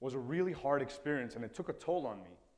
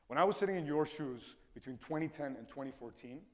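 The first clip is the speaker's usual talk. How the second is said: ordinary delivery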